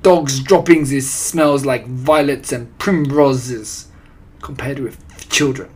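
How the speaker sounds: scornful witch pronouncement; Grand High Witch. A narrator's reading. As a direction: rant with heavy foreign accent. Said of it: "screeched the Grand High Witch".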